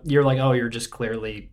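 ordinary talk, nothing marked

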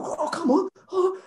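high, far-off voice of a dazed boxer; imitating a dazed fighter who won't stay down; mock dazed protest. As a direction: play the floored man insisting he's fine. Footballer getting up after knockout.

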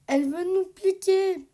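high, loud panicked cry; frightened yelp. A narrator's reading. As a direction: yelp it out in fear. Dog panics at angry bees. (cri de panique, aigu et fort)

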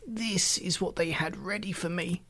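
put-on high, soft, plaintive old voice; quavering old man. A narrator's reading. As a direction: show your grievance in a quavering voice. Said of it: "he quavered".

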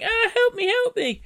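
put-on high, thin girl's plea; little girl crying for help. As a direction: cry out like a scared little girl. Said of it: "the girl's voice going".

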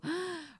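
sharp, high intake of breath; dramatic gasp. Pretend. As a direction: gasp in mock dread. Mock dread at room 217.

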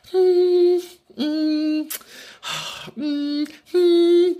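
high-pitched sceptical hums with a sigh between; doubtful humming. Acted. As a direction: hum high, as if weighing it up. Acts out reaction to Guinness answer.

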